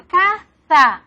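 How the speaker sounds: "caza" said with a th, "catha"; ceceo. Shown as a demonstration. Teacher demonstrates the ceceo on "caza". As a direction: stretch out "caza" with a lisped th. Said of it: "el ceseo sería pronunciar".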